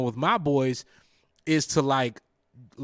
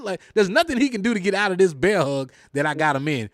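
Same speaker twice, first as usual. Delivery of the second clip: usual speaking voice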